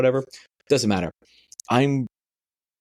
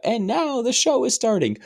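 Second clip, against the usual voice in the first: high, grand announcer voice; 14 semitones higher than usual. Retold or imitated. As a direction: announce the show's opening with flourish. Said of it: "Fucking [name] is doing it".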